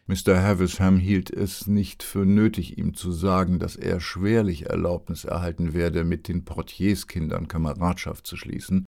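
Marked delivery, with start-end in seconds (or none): none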